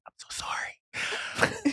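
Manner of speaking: slow, hushed whisper of sorry; whispered apology. Retold or imitated. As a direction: whisper it, embarrassed to interrupt. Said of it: "She's like".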